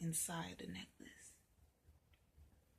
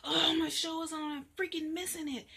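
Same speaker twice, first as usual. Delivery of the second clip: loud, higher frustrated whine; angry; 8 semitones higher, 7 dB louder, faster than usual. Acted. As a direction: fume about the show. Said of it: "getting so angry".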